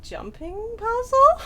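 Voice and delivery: very high, loud, slow rising question; voicing her brain's hopeful guess at pillars; eager brain voice. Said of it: "my brain ... is like".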